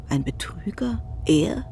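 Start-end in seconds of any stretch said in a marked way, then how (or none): none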